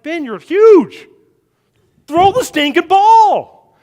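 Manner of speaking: urging shout. Retold or imitated. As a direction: bellow it like a coaching dad. Dad urging son to throw.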